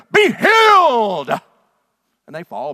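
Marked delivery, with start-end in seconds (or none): 0.1-1.3 very loud, much higher, slow hammed-up healer's yell; mock faith healer. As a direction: ham up a stage faith healer's cry. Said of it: "and they go".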